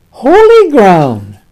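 slow, weighty, drawn-out "Holy ground"; slow solemn emphasis. Acted. Preacher repeating God's words to Moses, slow and solemn. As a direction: lean hard on "Holy", let "ground" fall.

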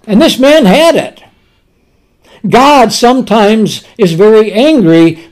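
usual speaking voice

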